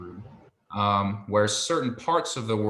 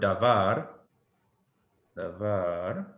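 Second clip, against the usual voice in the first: davar stressed on its last syllable, da-VAR; stress on final syllable; much slower than usual. Shown as a demonstration. Hebrew teacher sounding out davar, stressed on var. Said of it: "will have the emphasis".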